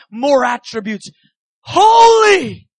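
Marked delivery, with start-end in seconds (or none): 1.6-2.7 one high, loud, drawn-out cry; awed proclamation. Acted. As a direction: call it out in overwhelmed worship. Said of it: "they begin to proclaim".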